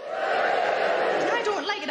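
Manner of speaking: slow, laughing "I don't like it"; drawn-out complaint. Acted. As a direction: stretch it out in mock dismay.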